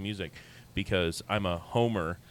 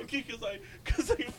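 high giggling; can't stop laughing. Real, not acted. Still cracking up.

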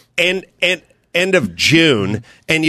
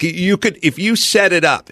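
ordinary talk, nothing marked

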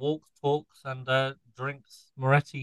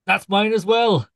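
a raised, louder comic punchline in a Tommy Cooper voice; 5 semitones higher, 4 dB louder, slower than usual. Acted. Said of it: "in the style of, um, Tommy Cooper".

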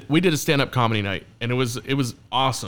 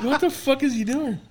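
much higher, retold disbelief; incredulous; 11 semitones higher, 3 dB quieter than usual. Host recalls watching comic attack everyone, incredulous. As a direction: replay your disbelief in a high voice. Retells own disbelief at the set.

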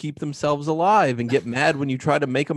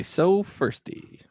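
so firsty said slowly and higher; slow, drawn out; 6 semitones higher, much slower than usual. Shown as a demonstration. Host repeating the hashtag name, slowly and higher. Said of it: "That is S O F E R S T Y".

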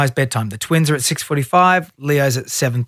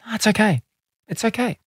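much higher, fading soft reassurance; self-soothing; 6 semitones higher, 5 dB quieter than usual. Retold or imitated. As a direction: talk yourself down, quieter each time. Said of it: "then I thought".

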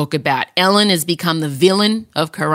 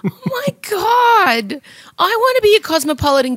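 mock despair; 12 semitones higher than usual. Real, not acted. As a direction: wail it like it is unfair. Mock outrage at dinner.

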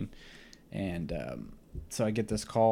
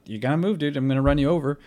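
raised, louder warning to get moving; retold urgent warning; 4 semitones higher, 6 dB louder than usual. Driver to the man blocking the car, urgent warning. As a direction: warn him off, higher and louder.

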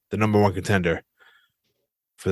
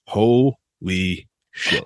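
drawn-out, stunned holy shit; slow amazed exclaim; much slower than usual. Real, not acted. Host stunned by the big week, slow amazed exclaim. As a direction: let it out slowly, stunned. Reacting to fantasy standings.

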